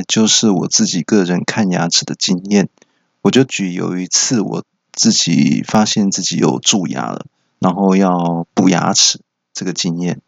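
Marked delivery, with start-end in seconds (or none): none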